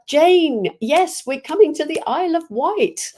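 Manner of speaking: light up with excitement, pitch swooping up; excited greeting. Real, not acted. Host answers viewer Jane about coming to visit, excited.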